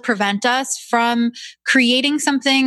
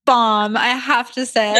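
normal talking voice

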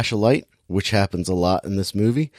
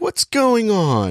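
high, drawn-out panicked question; mock freaking out; 11 semitones higher, slower than usual. She sees police lights outside her house, mock freaking out. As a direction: stretch it out in hammed-up alarm. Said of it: "she's freaking out".

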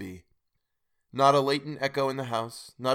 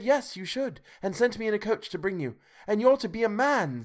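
put-on much higher, bright child voice; eager little sister fetching her brother; little girl; 8 semitones higher than usual. A narrator's reading. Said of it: "brimful of glee".

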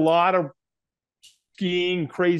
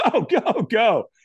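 high, rapid-fire urging; urging trainer; 7 semitones higher, slower than usual. Retold or imitated. Trainer launching firewalker onto the coals, urgent. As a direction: send them off with an urgent push.

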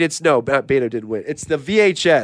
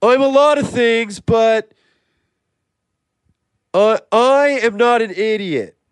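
wounded protest; 6 semitones higher, 3 dB louder than usual. Acted. Still protesting the idiot insult.

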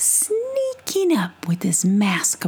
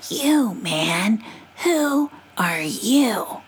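squint and question like a wary possum; 3 semitones higher, much slower than usual. Acted. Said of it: "in her possum voice".

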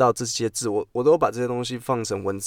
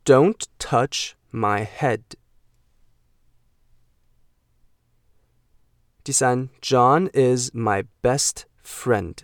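every word drawn out very slowly; slow model sentence; much slower than usual. Shown as a demonstration. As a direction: read it slowly for the class to repeat. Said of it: "model sentences".

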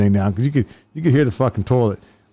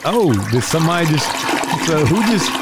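mock sniffing suspect; 6 semitones higher than usual. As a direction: ham up the startled dealer. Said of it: "It's like".

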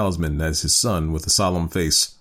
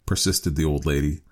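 plain reading voice

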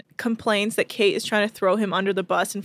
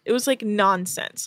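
ordinary talk

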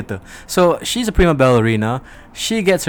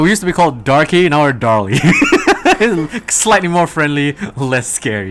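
mock spokesman voice; 7 dB louder than usual. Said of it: "It's like, you know".

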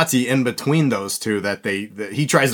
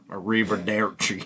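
put-on straight Virginian accent; 2 semitones lower, 6 dB quieter than usual. Imitating him saying goodbye in Italian, straight Virginian accent. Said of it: "he just says in a straight Virginian accent".

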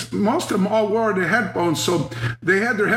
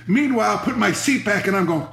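ordinary talk

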